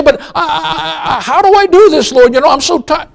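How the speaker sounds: high, halting put-on complaining voice; lightly laughing mock whine; whiny tired churchgoer. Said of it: "You say".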